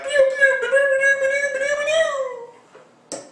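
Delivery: very high pew pew noises; high-pitched blaster pews; blaster sounds. Retold or imitated. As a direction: fire imaginary lasers. Imitating blaster fire.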